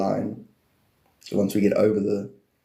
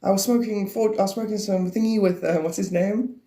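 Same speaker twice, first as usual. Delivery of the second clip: high stoner impression; 9 semitones higher than usual. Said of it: "He's like".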